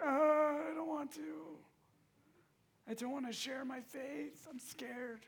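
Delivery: mock whiny scared teen, high; reluctant teen. Pastor mimicking scared students dodging outreach, mock whine. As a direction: play the nervous kid who won't share. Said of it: "you guys are like".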